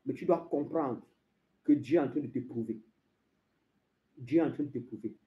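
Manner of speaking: low, soft preaching; hushed, confiding. Acted. Preacher explaining God's test to the believer, hushed. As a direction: bring it right down to explain. Confiding after the loud proclamation.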